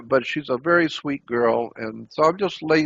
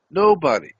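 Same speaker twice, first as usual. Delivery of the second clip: Nobody said higher and louder, punched hard; emphatic Nobody; 4 semitones higher, 5 dB louder than usual. Real, not acted. Telling listeners nobody knew, with insistent stress. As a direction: stamp the word Nobody with full conviction.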